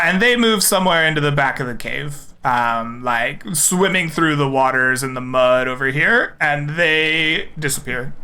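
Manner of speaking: usual speaking voice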